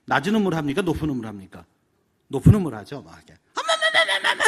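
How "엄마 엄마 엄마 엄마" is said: very high, loud, laughing calls; breathless excited child. Retold or imitated. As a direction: squeal for mom like an excited daughter. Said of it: "숨 넘어가면서". (숨 넘어가듯 신나서 엄마를 부르는 딸)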